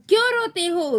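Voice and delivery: high-pitched cross demand; angry. A narrator's reading. Raat Rani to the crying children, angry. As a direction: snap the question in a high voice. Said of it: "रात रानी भी गुस्से में बोल रही है".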